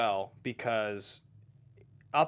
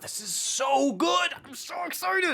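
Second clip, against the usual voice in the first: much higher, slow squealing excitement; squealing whisper; slower than usual. Retold or imitated. Viewer gushing to a friend mid-movie, high squeal. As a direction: squeal it like you can't contain yourself. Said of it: "You're just kinda like".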